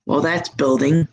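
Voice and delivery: usual speaking voice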